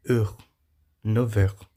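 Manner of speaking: "Heure" with rounded E and final R, then "Neuf heures"; French vowel demo. Shown as a demonstration. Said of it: "a própria letra E".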